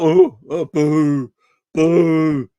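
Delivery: low, drawn-out jeer; mock booing. Critics jeering Slot's honesty, mock booing. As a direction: imitate a disapproving crowd booing.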